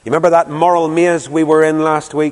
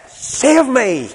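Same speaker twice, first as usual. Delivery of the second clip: retold plea; 10 semitones higher than usual. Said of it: "suddenly he cried".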